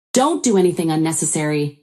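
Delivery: ordinary delivery, nothing marked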